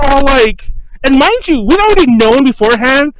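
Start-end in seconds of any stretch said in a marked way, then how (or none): none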